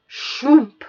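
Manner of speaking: loud landing whoosh effect; a big comic shoomp; whoosh sound. Acted.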